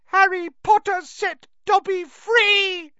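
shrill, loud elf cry; shrill Dobby. A narrator's reading. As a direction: squeal it high, joyful and loud. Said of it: "said the elf shrilly".

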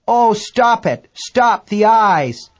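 high, slow, drawn-out plea; distressed female crew member. A narrator's reading. Chinese woman begs as the creature attacks, high wailing plea. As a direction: beg in a high, stretched cry. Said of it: "female Chinese voice".

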